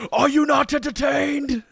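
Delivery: high, loud hammed-up arena shout; theatrical movie-line yell; mock gladiator roar. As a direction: bellow it at the crowd like a gladiator. Quoting famous gladiator movie line.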